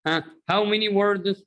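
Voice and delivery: normal talking voice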